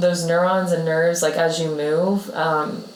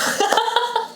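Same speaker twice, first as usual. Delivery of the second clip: high burst of laughter. Real, not acted. Co-host laughing high at the health advice. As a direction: crack up high at your co-host's point.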